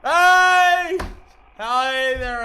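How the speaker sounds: high excited yelling; screaming. Real, not acted. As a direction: shout with joy. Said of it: "you can hear me screaming from the other room".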